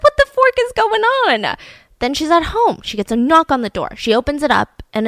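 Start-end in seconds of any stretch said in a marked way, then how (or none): 0.0-1.6 very high-pitched exclamation of disbelief; mock outrage. Wife hears about the other woman, high mock outrage. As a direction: squeal it in comic indignation. Retold wife's outraged reaction.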